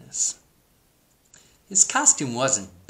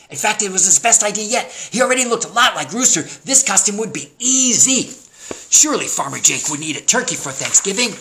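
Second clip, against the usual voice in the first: high, loud, quick storybook read; excited story narration; 10 semitones higher, 10 dB louder than usual. A narrator's reading. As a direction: build up Turkey's brilliant idea for children. Excited story narration of Turkey's idea.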